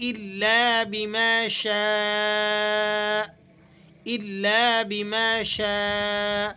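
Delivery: شاء stretched four counts; very slow الا بما شاء; four-count madd. Shown as a demonstration. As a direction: hold the aa of شاء long. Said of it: "چار الف کے قائم مقام کھینچ کر ادا کرنا".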